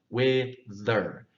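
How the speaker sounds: wither with a clear TH; model pronunciation. Shown as a demonstration. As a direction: finger on the chin, say wither with the tongue out. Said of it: "then say the T H sound".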